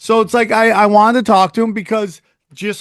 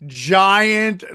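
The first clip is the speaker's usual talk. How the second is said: normal talking voice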